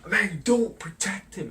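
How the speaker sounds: a hammed-up angry uncle; mock yell. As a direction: uncle shouting at aunt. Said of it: "he starts screaming".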